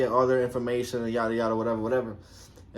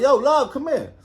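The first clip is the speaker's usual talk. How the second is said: higher, louder call across the street; 10 semitones higher, 6 dB louder than usual. Retold or imitated. Officer calling Love back across the street, loud call. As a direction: shout her name to get her back.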